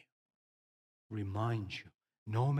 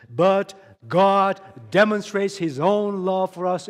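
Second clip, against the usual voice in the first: loud slow congregational reading; 5 semitones higher, 11 dB louder than usual. Acted. Leads congregation reading verse.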